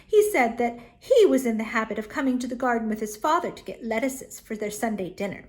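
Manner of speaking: ordinary delivery, nothing marked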